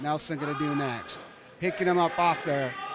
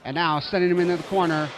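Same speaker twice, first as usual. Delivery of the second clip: normal talking voice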